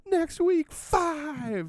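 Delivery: high excited yell; excited screaming. Retold or imitated. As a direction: scream it out with glee. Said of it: "excitedly screaming it to everyone".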